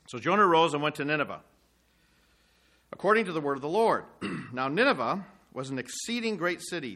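measured reading of scripture. Acted. Preacher reading Jonah's prayer to the congregation, measured. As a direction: read the prayer steadily to the listeners. Preacher rereads verse three.

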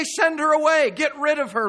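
loud, high demand; impatient disciples. Retold or imitated. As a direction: act out disciples urgently demanding dismissal.

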